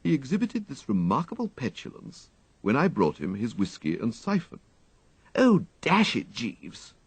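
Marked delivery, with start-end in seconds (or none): 5.3-6.9 higher, exasperated toff voice; a fed-up young master snapping at his valet; petulant upper-class complaint; overwrought young gentleman. A narrator's reading. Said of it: "manifestly overwrought".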